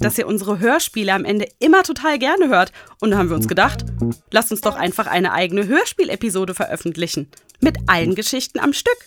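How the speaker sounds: usual speaking voice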